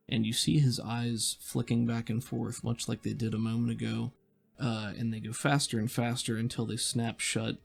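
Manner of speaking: normal talking voice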